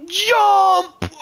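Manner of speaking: a real startled cry as the jump launches; shouted jump. Real, not acted. Forcing a risky jump in game.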